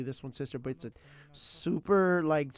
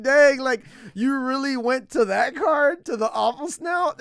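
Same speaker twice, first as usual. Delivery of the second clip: amazed; 11 semitones higher, 13 dB louder than usual. Retold or imitated. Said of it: "They're like".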